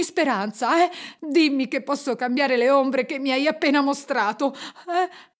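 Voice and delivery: high, frantic begging; desperate pleading. A narrator's reading. Scrooge begs the spirit to change his fate, desperate. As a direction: beg with rising panic, stammering denials.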